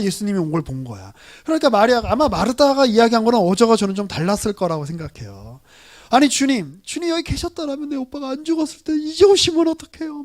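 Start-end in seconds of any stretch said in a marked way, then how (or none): 6.1-10.2 imitated tearful reproach. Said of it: "막 이렇게 하면서 울었을 것 같아요".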